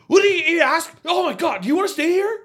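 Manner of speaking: loud, high-pitched exasperated outburst; snapping angrily. Acted. Q snapping at Wade about staying or sliding, acted angry and loud. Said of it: "Q snaps on her".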